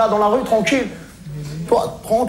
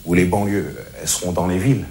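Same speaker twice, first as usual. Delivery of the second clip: much lower, softer, earnest voice; calmed-down sincere; 8 semitones lower, 3 dB quieter than usual. Acted. Reformed street guy shares his wise message, calm and low. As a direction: settle in and preach calmly. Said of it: "je me suis calmé".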